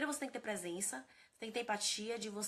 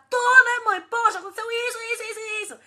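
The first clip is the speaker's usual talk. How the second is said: much higher and louder, hammed-up venting teen; upset teenage daughter; 13 semitones higher, 20 dB louder than usual. Teenage daughter venting to mom about boyfriend, loud and high. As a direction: gush it out like an upset teen girl. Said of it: "E aí ela vai falar".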